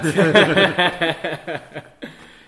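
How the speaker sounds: loud burst of laughter; hearty laugh. Real, not acted. Laughing at moustache joke.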